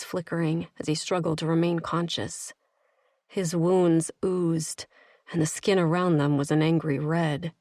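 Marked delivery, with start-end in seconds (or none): none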